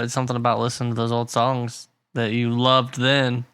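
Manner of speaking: ordinary talk, nothing marked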